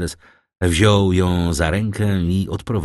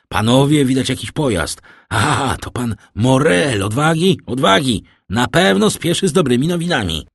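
high, loud, eager shouts; excited calling out; 7 semitones higher, 5 dB louder than usual. A narrator's reading. Guest spotting Morel's carriage, calling out excitedly. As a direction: shout the news to everyone, urging courage. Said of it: "Zawołał któryś z biesiadników obserwujący drogę".

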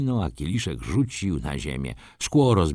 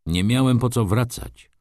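higher, impatient retort; impatient reply; 6 semitones higher, 3 dB louder than usual. A narrator's reading. Wokulski brushes off his friend's questions, impatient. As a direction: answer as if fed up with questions. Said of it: "odparł niecierpliwie Wokulski".

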